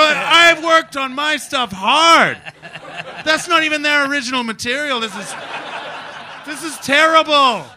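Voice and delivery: high, loud hammed-up outrage; indignant yelling. Comic upstaged by his own children, mock-indignant high yelling. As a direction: yell it like an upstaged performer. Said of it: "And I go".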